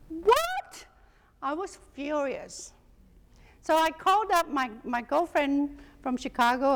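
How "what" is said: a sudden very high startled "What?"; shocked disbelief. Retold or imitated. Hearing the friend's news, a very high shocked yelp. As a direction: squeak it out, jaw on the floor. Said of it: "my jaw hit the floor and I go".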